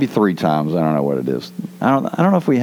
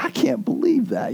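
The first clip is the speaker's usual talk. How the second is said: amazed disbelief; 10 semitones higher, 4 dB quieter than usual. Retold or imitated. Pilot amazed at the silence.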